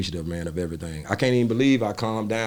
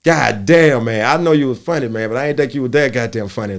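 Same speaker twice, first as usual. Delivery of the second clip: louder, lightly hammed-up hype; excited fan; 3 semitones higher, 8 dB louder than usual. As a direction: gush over the show like a fan. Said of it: "they do it in a way that's like".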